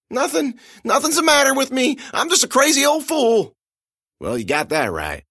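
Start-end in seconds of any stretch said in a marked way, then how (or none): 0.1-3.5 high, whimpering plea; pleading old man. Acted. Old man pleading with his attacker, high whimper. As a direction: beg for mercy, pitched high.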